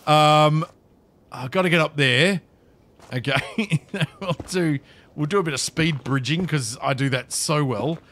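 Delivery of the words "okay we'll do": ordinary delivery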